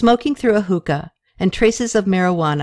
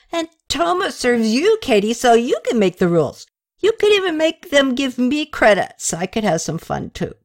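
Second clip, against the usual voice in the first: put-on higher, gushing drunk friend; tipsy young man; 6 semitones higher than usual. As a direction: babble the news, too excited. Drunk Rene voiced by reader.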